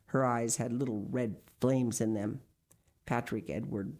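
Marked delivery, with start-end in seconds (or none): none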